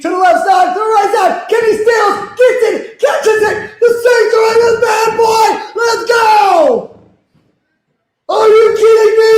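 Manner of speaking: high, loud, near-screaming call; ecstatic play-by-play. Real, not acted. Fan calls Saints catch to chat, high screaming joy.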